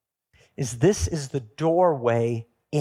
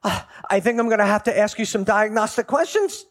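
higher, louder, fussy bureaucrat quiz; officious supervisor angel; 8 semitones higher, 5 dB louder than usual. Acted. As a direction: grill him like a pompous official. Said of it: "the supervisor angel comes and goes".

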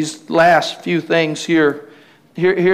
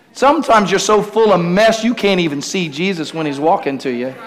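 normal talking voice

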